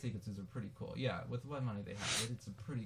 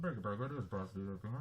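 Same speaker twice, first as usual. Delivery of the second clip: over walkie talkie; 4 semitones lower, much faster than usual. Pretend. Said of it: "Little walkie-talkie thing".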